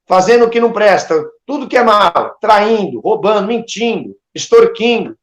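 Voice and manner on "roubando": ordinary delivery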